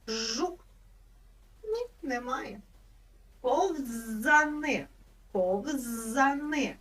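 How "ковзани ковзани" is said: Ковзани said very slowly; slow spelling-out. Shown as a demonstration. Teacher stretching Ковзани to find its з. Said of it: "Є звук з".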